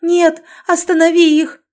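high, loud pleading scream; desperate scream. Acted. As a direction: beg him to stop it, screaming. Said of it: "яростно заорала". (отчаянный крик)

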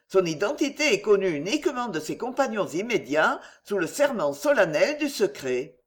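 usual speaking voice

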